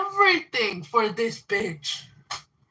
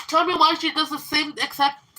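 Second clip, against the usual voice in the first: ordinary talk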